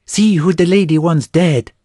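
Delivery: high, loud eager shout; cheeky boy shouting from the crowd; boy crying out. A narrator's reading. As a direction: yell it to get the crowd laughing. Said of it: "cried the boy".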